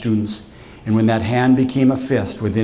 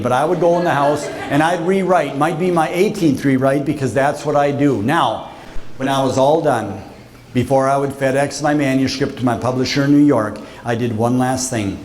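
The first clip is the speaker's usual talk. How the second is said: ordinary delivery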